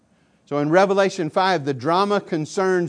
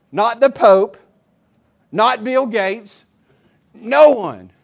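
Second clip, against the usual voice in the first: louder and higher on each name, slowing on "Bill Gates"; building emphasis; 5 semitones higher, 10 dB louder, slower than usual. Real, not acted. Preacher listing who cannot open the scroll, building loud. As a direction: hammer each name harder.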